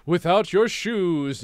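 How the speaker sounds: loud, high, drawn-out royal voice; stretched-out comic monarch decree; interrupting king. A narrator's reading. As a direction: cut in slowly and loudly as the king. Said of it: "interrupted the king".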